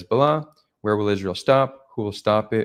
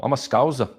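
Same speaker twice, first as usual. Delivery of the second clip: higher-pitched, lilting Liverpool accent; Scouser accent; 5 semitones higher than usual. Shown as a demonstration. Streamer showing viewers a favourite accent, playful Scouse. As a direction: put on an amateur Scouse accent. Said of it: "The Scouser accent, Liverpool".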